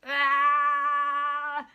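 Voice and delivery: loud, drawn-out "aah"; frustrated groan. Real, not acted. Vlogger losing their place in the notes, frustrated cry.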